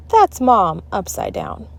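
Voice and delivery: ordinary delivery, nothing marked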